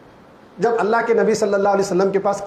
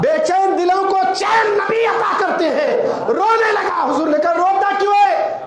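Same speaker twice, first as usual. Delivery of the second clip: fervent pulpit cry; preaching at full force; 10 semitones higher than usual. Acted. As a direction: call it out to the whole congregation. Preacher rises to climax.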